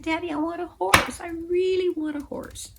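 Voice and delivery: put-on high, yearning child's plea; child pleading. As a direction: plead with Daddy like a little girl. Child pleading with her daddy.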